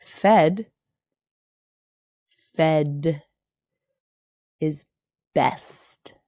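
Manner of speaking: Fed, fed is best, each word spaced and weighted; slow emphatic. Shown as a demonstration. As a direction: hammer each word with long pauses. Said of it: "Let me say it one more time for emphasis".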